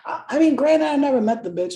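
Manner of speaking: usual speaking voice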